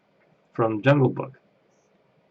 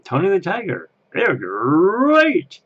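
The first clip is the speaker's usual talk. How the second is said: Tony the Tiger; 8 semitones higher, 6 dB louder, slower than usual. Retold or imitated. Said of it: "his name was [name]".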